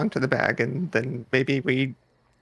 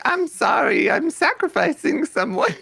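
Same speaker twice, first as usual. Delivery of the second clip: mock apology; 10 semitones higher, 3 dB louder than usual. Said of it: "I don't think they're like".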